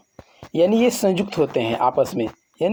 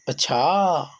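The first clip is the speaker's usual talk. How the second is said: अच्छा with a long-held, falling final vowel; surprised अच्छा, last syllable lengthened; drawn-out exclamation; much slower than usual. Shown as a demonstration. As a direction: stretch the end of अच्छा as if astonished. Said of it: "अनुतान अंत में लंबा बोलेंगे".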